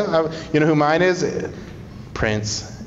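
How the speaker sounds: usual speaking voice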